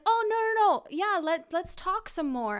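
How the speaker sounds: high, loud imitation of an insistent guest; mock pleading. Date wanting to stay and talk, loud high protest.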